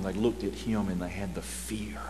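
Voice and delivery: a reverent hushed whisper. Acted. Preacher to congregation, awed whisper.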